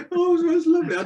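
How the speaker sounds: laughing. Real, not acted. Laughing after telling the joke.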